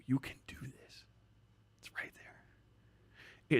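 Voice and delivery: whispered, no voice; an encouraging whisper to the viewer. Real, not acted. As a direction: hush it like a secret promise.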